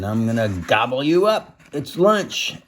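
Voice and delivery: lightly voiced hungry troll; a hint of gleeful troll menace. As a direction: threaten playfully as the troll. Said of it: "He's saying".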